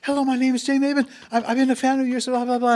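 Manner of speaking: loud, high starstruck gabble; mock nervous babble pitched far up; voice gone way up. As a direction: replay a fan's squeaky, over-excited phone introduction. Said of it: "my voice went up about six octaves".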